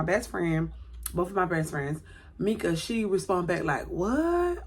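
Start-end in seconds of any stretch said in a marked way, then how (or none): 3.9-4.6 high surprised what. Retold or imitated. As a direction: pitch it up in shock. Best friend reacts to news.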